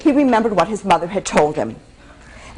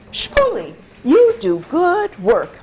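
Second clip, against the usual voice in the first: lightly voiced kindly tailor, a bit higher and slower; a hint of a proud tailor; 7 semitones higher, slower than usual. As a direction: praise the boy like a pleased old tailor.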